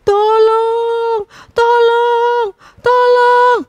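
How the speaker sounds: high, loud panicked calls; cries for help. A narrator's reading. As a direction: scream for help from the trap. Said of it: "suara kutilang minta tolong".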